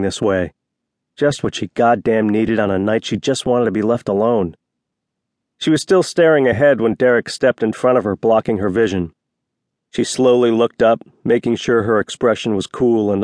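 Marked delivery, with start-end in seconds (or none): none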